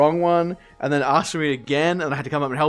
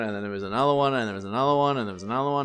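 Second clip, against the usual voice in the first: monotonous looping list, getting softer; weary run-on repetition; 3 semitones lower, 4 dB quieter, much faster than usual. Retold or imitated. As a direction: run them together, trailing away. Mimicking endless run of calls.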